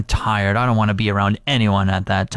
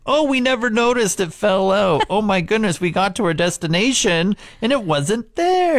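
high mock innocent voice; 8 semitones higher than usual. Mock careless driver quote.

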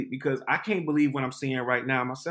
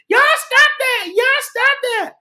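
very high, loud imitation of a screaming woman; retold panicky high yell; acted-out screaming; 7 dB louder, slower than usual. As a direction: scream it high to break up the fight. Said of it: "I can hear Tina screaming".

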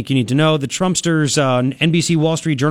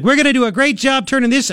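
mocking impression, much higher pitch; mock quote; 9 semitones higher than usual. Host mocking a candidate's rally pep talk, high mimicking voice. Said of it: "And she's like".

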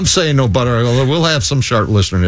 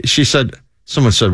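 ordinary delivery

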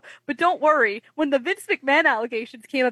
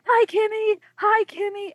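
high-pitched fangirl call; imitating screaming fans; 10 semitones higher than usual. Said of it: "like, screaming my name".

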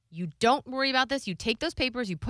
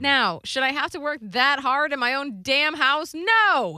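indignant rant; 5 semitones higher, 6 dB louder, slower than usual. Real, not acted. Indignant rant about working so hard.

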